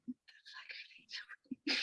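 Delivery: whispered aside to herself; hushed disbelief. Retold or imitated. Patient spotting tourists at the hospital entrance, hushed disbelief. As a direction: whisper it, barely any voice. Muttered reaction to visitors arriving.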